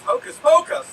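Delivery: high, loud mock exclamation; called-out punchline. Magician named Hocus answering at the door, called out high.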